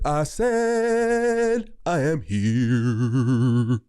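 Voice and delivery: stretched-out shout of I am here; proud shout. Real, not acted. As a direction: announce it slowly to the crowd. Calling out emphatically.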